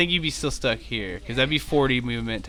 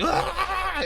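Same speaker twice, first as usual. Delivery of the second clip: high-pitched mock frenzy scream; long, high crazed "aah". Grappled werewolf princess going crazy, high mock scream. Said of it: "starts going crazy".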